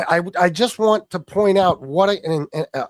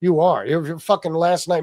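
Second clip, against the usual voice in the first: normal talking voice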